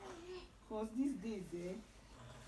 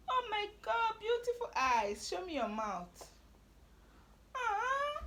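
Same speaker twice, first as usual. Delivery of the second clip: gushing admiration; 10 semitones higher than usual. Real, not acted. Mother gushing over eyes.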